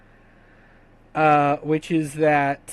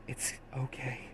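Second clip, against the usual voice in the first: much softer soothing murmur; whispered comfort; 3 semitones lower, 17 dB quieter than usual. Acted. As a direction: hush him gently, close to his ear. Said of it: "she whispers in your ear".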